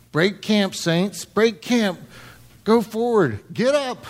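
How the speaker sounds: rally the saints to move out; rousing command. Acted. Acting out the order to march.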